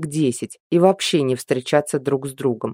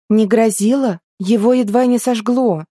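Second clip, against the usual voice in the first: higher, louder, incredulous echo; indignant disbelief; 7 semitones higher, 4 dB louder than usual. Acted. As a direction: throw the words back in disbelief. Incredulous retort to the claim.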